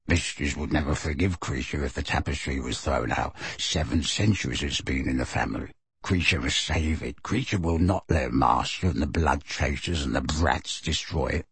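low, soft elf muttering; fast mutter. A narrator's reading. The elf pleading for the tapestry, low mutter. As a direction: grumble it low under the breath. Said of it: "then muttered very fast".

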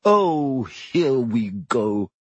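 slow, drawn-out grumble; bitter. A narrator's reading. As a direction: groan the words with weary resentment. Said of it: "said Nearly Headless Nick bitterly".

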